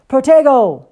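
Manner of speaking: shouting the spell at full force, high and loud; yelled spell. Acted. Harry shields himself from Snape's attack, yelling loud. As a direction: blast the incantation out as a reflex. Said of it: "He yelled".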